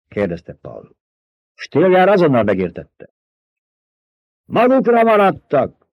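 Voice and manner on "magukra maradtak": a high, drawn-out cry from the crowd; shrieked from the crowd. A narrator's reading. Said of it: "rikoltotta valaki". (rikoltás a tömegből, magas, elnyújtott kiáltás)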